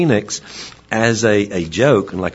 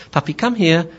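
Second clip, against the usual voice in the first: higher-pitched, friendly call to a puppy; 10 semitones higher than usual. Acted. Owner calling puppy in before confinement, higher-pitched call. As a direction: call the pup in on a raised pitch.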